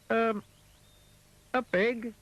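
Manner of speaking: high, hesitant and simple; high simple Mister Dizzy. Acted. Mister Dizzy answers the elephant, high and unsure. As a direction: answer like a dim, eager fool.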